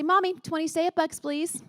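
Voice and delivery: put-on high-pitched eager child voice; little daughter's plea. Daughter asking mom for her morning reward, eager little-kid voice.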